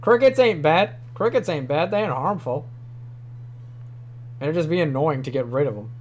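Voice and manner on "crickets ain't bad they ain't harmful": ordinary talk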